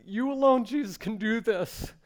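high, loud declaration of faith to Jesus; crying out in prayer. Retold or imitated. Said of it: "you cried out".

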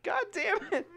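high, shrieked grandma outburst; shrieking grandma. Retold or imitated. Grandma shrieking at a chubby grandkid, high shriek. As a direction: shriek it like an old lady. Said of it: "she'd be like".

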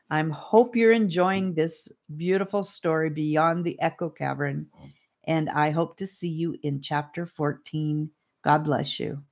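ordinary delivery

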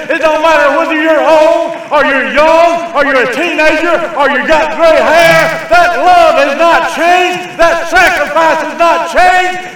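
high, full-force preacher's shout; loud, impassioned pulpit cry, climbing in pitch; raw preaching. Real, not acted. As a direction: build to a sustained shout, drawing out the final declarations. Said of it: "I get a little raw".

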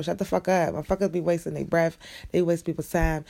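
ordinary talk

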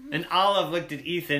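plain reading voice

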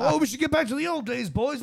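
high, lightly snide teasing; snide joke. Retold or imitated. Paul mocking the band about old days, lightly snide. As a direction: needle the boys with a smirk. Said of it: "this snide, like, joke".

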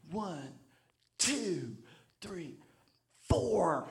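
soft, slow counting under the breath; careful counting. Retold or imitated. Drummer overthinking the timing, counting softly. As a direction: count each beat very deliberately.

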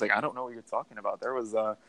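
usual speaking voice

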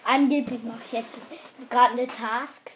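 loud, mock-innocent claim; loud fake innocence; loud ironic claim. Real, not acted. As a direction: announce it loudly and slyly.

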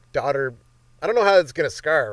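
ordinary delivery

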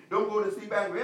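ordinary talk, nothing marked

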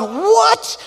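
high, loud alarmed yelp of disbelief; a sudden startled shout, voice jumping way up; shocked shout. Retold or imitated. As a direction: blurt it out like a panicked new parent. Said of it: "And I'm going".

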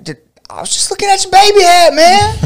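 high, fast, innocent protest; defensive own reply. Retold or imitated. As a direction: squeak out a nervous excuse. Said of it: "And I was like".

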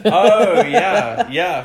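high, loud laughing yeah. Real, not acted. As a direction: laugh through the yes. Laughing at the ice bath question.

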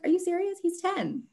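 high-pitched mock disbelief; incredulous. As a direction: imitate a colleague who can't believe the news. Acts out other teacher's shocked reply.

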